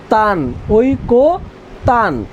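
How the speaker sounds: "ঐকতান" drawn out as oi-ko, then tan; slow syllable demonstration. Shown as a demonstration. Teacher repeating what we say, "ঐকতান" slowly split into oi-ko-tan. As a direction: stretch "তান", then "ঐকতান" slowly, closing on n. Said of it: "কি বলি?".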